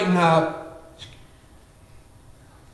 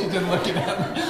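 laughing; 5 semitones higher than usual. Real, not acted. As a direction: laugh at being caught out. Laughing with the congregation.

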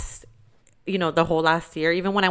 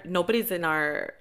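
ordinary talk, nothing marked

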